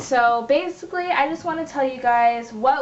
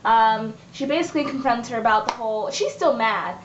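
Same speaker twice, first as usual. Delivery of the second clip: ordinary delivery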